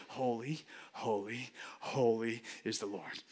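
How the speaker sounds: under your breath. Shown as a demonstration. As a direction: mutter the praise privately under your breath. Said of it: "but under your breath".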